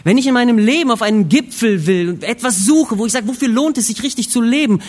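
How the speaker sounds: impassioned preaching. Real, not acted. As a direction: preach it out with full urgency. Prediger steigert sich eindringlich. (laut und eindringlich predigend)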